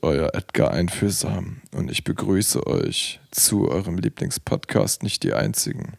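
langsam, leise, uralt klingend; theatralischer Greis. Pretend. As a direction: wie ein hundertfünfzigjähriger Schauspieler. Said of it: "theatralisch und mit hundertfünfzig Jahren Lebenserfahrung in der Stimme". (langsame, theatralische Altmännerstimme)

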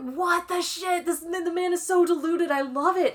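high-pitched incredulous fan rant; real gleeful disbelief. Real, not acted. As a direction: gush in amazed outrage. Gushing disbelief at deluded villain.

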